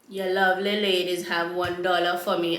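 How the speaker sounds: put-on Rastaman accent. Rastaman asking two ladies for a dollar, imitated Rastafarian accent.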